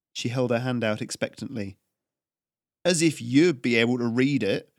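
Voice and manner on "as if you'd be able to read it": louder, sneering jibe; mock her with contempt; scoffing. A narrator's reading. Tax collector scoffs at Haryana, sneering. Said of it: "the tax collector scoffed".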